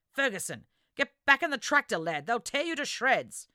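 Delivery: loud, high warning yell across the mud; urgent shout. Acted. Lachlan yells at Barry to get back inside, urgent shout. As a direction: bellow it so the lad hears you over the battle.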